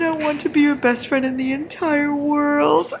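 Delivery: high, slowed-down lovestruck read; swoony quote. Retold or imitated. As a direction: savor each word like a dreamy hero. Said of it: "He said".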